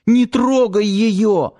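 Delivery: high, drawn-out plea; tearful pleading. A narrator's reading. Crying girl begging him to spare the mouse, pleading. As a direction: beg on the edge of tears, voice pitched high. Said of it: "взмолилась плачущая девушка".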